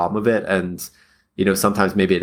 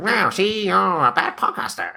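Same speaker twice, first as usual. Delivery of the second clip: much higher, hammed-up old-timey mobster; cartoon gangster voice; 12 semitones higher than usual. Host's inner-critic gangster mocking him, much higher. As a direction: sneer it like a cartoon crook. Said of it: "I did a voice earlier".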